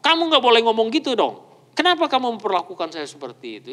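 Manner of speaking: indignant, pushy, then rushing on; demanding complaint. Retold or imitated. Imitating a partner who demands being treated right, indignant. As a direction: demand it like someone who expects their way. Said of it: "Itu kan nuntut orang lain".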